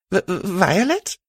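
stumbling over her name in shock; stuttering. Acted. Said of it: "I stuttered".

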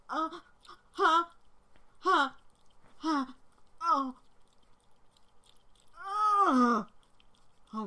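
breathless cries of pleasure; loud moaning. Real, not acted.

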